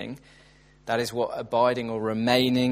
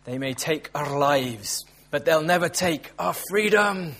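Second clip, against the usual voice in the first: Scottish battle cry, rising higher on the last line; rally the troops in a put-on Scottish accent; 4 semitones higher than usual. Preacher quoting Braveheart to the congregation, mock Scottish accent. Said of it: "I'm very sorry for the accent".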